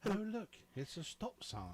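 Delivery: high-pitched mock British voice; hammed-up British; British accent. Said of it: "talking to myself in British".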